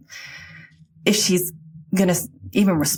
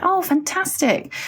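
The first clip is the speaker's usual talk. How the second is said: high and slow, lightly voiced mum; a hint of an elderly mother, drawn out; mum's reply; 13 semitones higher, slower than usual. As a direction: mimic her mum's slow, pleased answer. Daughter voices her ill mum.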